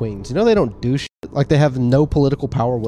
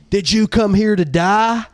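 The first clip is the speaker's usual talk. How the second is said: raised, slow drill sergeant challenge; joke-telling drill sergeant voice, pitched up; 7 semitones higher than usual. Acted. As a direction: confront the recruit like a drill sergeant, drawn out. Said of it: "drill sergeant comes up to him".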